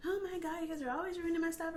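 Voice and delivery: imitated exasperated mother, pitched high; flipping out. Grandmother scolding over a toddler's spill, high exasperated imitation. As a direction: mimic a fed-up mom scolding in a high voice. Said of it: "like, just flip".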